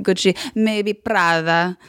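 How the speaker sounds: slow, grudging Italian fashion verdict; Italian-accented snob weighing it up. Retold or imitated. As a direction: concede it slowly in an Italian accent. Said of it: "an impression of him".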